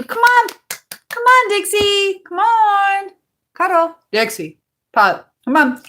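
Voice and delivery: high, loud call to the dog, coaxing. Real, not acted. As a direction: sing out to coax the puppy over. Calling the dog over.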